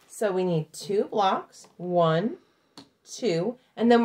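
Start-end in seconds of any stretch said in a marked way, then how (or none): none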